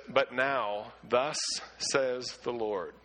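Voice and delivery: slow right down on "says the Lord" so the speaker stands out; stressed slow reading. Shown as a demonstration. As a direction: lean on "thus" and drag out "says the Lord" very slowly. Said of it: "I will try to emphasize this".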